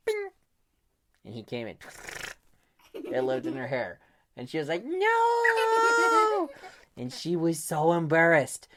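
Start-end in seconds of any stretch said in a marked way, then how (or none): 4.9-6.5 very high, loud wail. Acted. Girl finding a cockroach, high wail. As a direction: wail it in despair.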